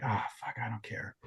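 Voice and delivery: hushed, giving in to temptation; whispering to himself before a big purchase. Retold or imitated. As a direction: whisper it like a guilty splurge. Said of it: "I'm like".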